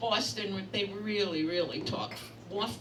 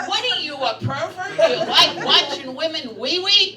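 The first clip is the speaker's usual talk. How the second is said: loud, laughing outrage at a creep; half-laughing, half-shouting accusation; indignant retort; 6 semitones higher, 10 dB louder than usual. Retold or imitated. Comic telling herself throwing back an indignant retort, laughing and loud. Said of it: "I said".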